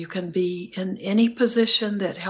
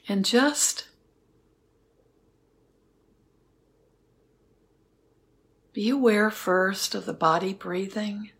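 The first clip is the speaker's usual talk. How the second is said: guided meditation. Acted. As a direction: guide them gently into stillness. Leads a metta meditation.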